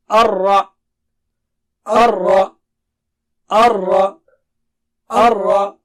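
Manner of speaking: Ar-Ra with a heavy, thick ra, said loud; loud teaching drill of Ar-Ra with a full ra. Shown as a demonstration. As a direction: stress the thick ra in Ar-Ra. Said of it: "Ra should be thick here".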